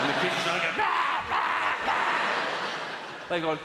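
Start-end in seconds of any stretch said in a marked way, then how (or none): 0.8-3.3 high shouted rah rah; mock wild roar. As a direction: roar like kids let loose. Kids going mad, imitated.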